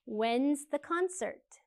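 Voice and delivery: spoken slowly for learners; slow model question. Shown as a demonstration. Teacher models the question slowly for students. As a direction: drill it slowly and clearly. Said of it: "what are the questions for these answers".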